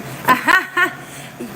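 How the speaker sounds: light burst of laughter; real, delighted little laugh. Real, not acted. Tarot reader thanked by a viewer, laughing.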